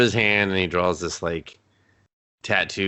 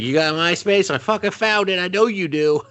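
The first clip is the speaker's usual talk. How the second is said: excited raised gloating; high gleeful brag; 12 semitones higher than usual. Retold or imitated. AI gloating to the hero about finding his MySpace, high excited brag. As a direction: crow about it, pitched way up.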